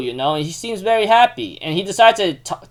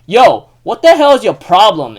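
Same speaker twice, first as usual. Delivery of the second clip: louder, confrontational mock outburst; retold angry challenge; 3 semitones higher, 7 dB louder than usual. Yuki confronts Manabe over the kicked sprouts, retold angry challenge. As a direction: call him out in a retold quarrel.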